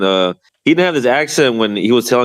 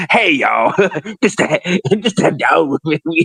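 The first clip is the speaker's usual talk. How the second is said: mock Southern accent; 6 semitones higher than usual. Guest mocking the boxer's sudden change, laughing Southern-accent imitation. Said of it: "he got real Southern all of a sudden".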